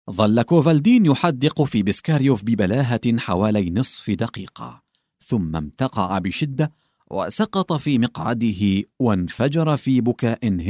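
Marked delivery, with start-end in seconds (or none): none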